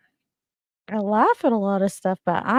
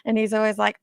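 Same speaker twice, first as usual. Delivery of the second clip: ordinary talk, nothing marked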